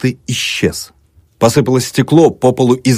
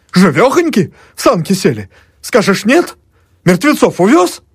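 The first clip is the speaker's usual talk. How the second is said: much higher, insistent protest; heated arguing; 10 semitones higher than usual. A narrator's reading. Emelyan insisting to the doctor, high heated protest. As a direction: argue it with rising heat.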